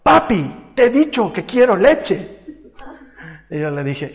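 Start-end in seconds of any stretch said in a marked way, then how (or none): 0.0-2.4 high, loud mock tantrum demand; imitated angry toddler. Toddler demanding milk from papi, mock angry. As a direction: playfully imitate a cross little girl. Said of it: "la Nicole se enoja".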